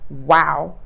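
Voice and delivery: ordinary delivery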